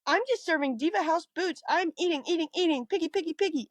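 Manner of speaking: high sing-song girly pop impression; girly pop diva. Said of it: "said in very girly pop".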